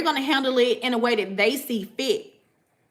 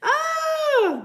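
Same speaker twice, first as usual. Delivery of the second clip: high sudden yelp; surprised shout. Real, not acted. Sudden outburst.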